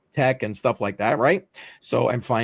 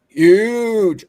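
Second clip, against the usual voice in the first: loud, raised, drawn-out impersonation; Trump; 8 semitones higher, 9 dB louder than usual. Acted. Host tries the Trump voice for a chat viewer, exaggerated impression. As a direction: stretch it out big in an amateur political impression. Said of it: "the Trump voice".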